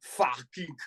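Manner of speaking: put-on angry German guy with a thick accent; slow angry German, drawn out sauerkraut. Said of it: "he goes".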